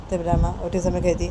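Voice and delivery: ordinary delivery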